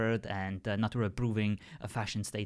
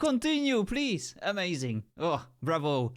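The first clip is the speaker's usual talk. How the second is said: high, louder, hammed-up applause for bad jokes; mock enthusiastic cheering; 8 semitones higher, 3 dB louder than usual. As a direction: cheer him on with over-the-top fake delight.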